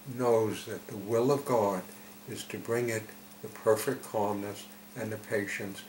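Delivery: ordinary talk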